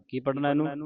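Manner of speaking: ordinary delivery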